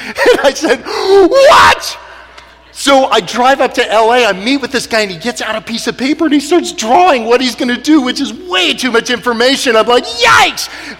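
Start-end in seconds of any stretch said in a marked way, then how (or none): 0.8-2.0 high, loud, drawn-out "what"; retold shout of utter disbelief; astonished yell. Reacting to a surgeon's claim he can fix the boy, astonished yell. 10.0-11.0 high retold shriek of alarm; startled yelp. Reacting to the surgeon's drawing, startled yelp.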